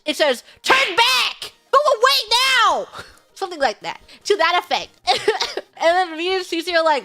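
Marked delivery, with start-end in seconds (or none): 0.6-2.9 loud, high aggressive shout. Acted. Spirits warning off visitors, aggressive shout. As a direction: shout a fierce warning to get out. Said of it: "in very aggressive English".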